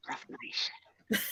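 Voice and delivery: sighing whisper; breathy mock defeat; whispered aside. Joke fell flat.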